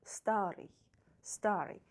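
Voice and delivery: старый stressed on the first а, ending ый unstressed. Shown as a demonstration. Teacher showing students the stressed а. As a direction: say старый as STA-ryj. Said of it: "another vowel а is stressed".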